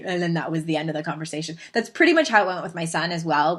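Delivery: ordinary talk, nothing marked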